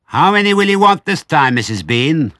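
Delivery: high, loud holler up the steps; piercing long-distance call; woman shouting. A narrator's reading. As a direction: yell the question to someone upstairs. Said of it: "the woman shouted".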